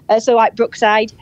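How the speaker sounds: usual speaking voice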